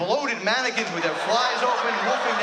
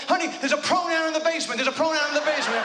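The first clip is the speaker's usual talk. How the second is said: high, frantic wife crying out in the night; panicked wife; 7 semitones higher than usual. Acted. Wife in bed waking her husband, high and panicked. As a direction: wake him up in a panic about the basement. Wife's scared whisper joke, acted.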